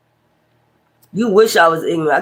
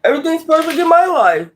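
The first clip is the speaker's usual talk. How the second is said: high-pitched mock perfection; put-on high voice, sending them up; mocking perfect people; 8 semitones higher than usual. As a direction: mimic them in a high, prissy lilt. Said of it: "Everything gotta be just perfect".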